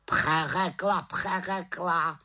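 high, drawn-out mimicking squawk; croaking raven. A narrator's reading. Raven echoes the witch's excuse, mocking croak. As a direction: parrot it back as a scornful bird. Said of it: "krákal havran".

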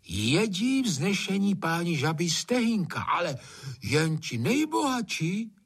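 put-on high-pitched old gamekeeper spinning a yarn; long-winded storytelling. A narrator's reading. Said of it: "Hajný už vykládal a pěkně ze široka".

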